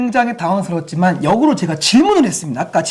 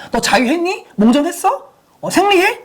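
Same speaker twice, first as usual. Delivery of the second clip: higher, mocking blunt questions; blunt parent mock; 10 semitones higher than usual. Shown as a demonstration. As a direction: fire off awkward questions like a clumsy parent. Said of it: "이렇게 하는 게 아니죠". (눈치 없이 캐묻는 부모 흉내, 높은 목소리)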